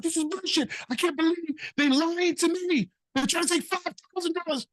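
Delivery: high-pitched imitated rant; hammed-up outrage, voice way up; irate client. Client yells about a five thousand dollar fee, high mock rant. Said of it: "he is going ballistic".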